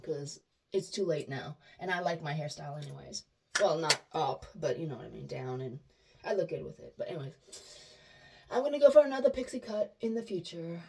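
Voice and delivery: ordinary talk, nothing marked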